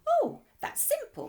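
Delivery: high, chirpy and light; high bright exclamation. A narrator's reading. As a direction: toss it off brightly. Mister Hoppy answers brightly.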